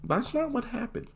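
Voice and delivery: very high, softened mock protest; timid protest. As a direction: squeak out a small objection. Meek protest to mom about principal's account.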